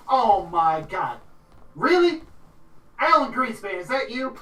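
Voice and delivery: high, loud squeal of disbelief; amazed recognition. Acted. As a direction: spot an old acquaintance and pitch right up. Gloria amazed to spot Alan.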